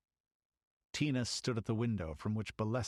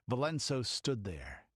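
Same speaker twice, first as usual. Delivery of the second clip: plain reading voice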